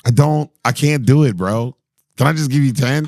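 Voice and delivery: ordinary talk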